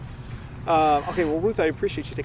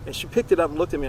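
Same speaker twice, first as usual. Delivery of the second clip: ordinary talk, nothing marked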